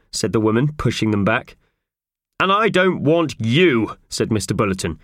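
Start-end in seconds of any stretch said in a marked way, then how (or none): 2.4-5.0 angry dismissal. Acted. Official angrily dismisses culprit.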